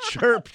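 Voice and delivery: loud high chirp; cricket chirp. Pretend. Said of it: "That was a cricket".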